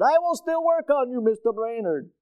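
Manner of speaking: imitating his Egyptian doctor's accent. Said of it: "But he said".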